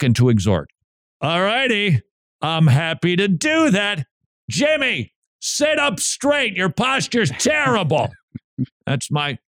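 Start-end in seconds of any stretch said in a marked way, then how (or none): 1.2-8.1 high, drawn-out scolding; mock rebuke. Host rebuking Jimmy's posture to parody obedience, mock rebuke. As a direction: gleefully overdo the telling-off. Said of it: "you want me to do what the Bible says".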